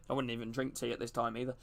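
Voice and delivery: muttered aside. Real, not acted. Telling chat about coffee, softly muttered aside. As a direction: say it half under your breath.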